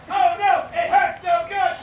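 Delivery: hammed-up agony. King being licked by the adorable kitten, hammed-up agony. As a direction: cry out in drawn-out comic torment.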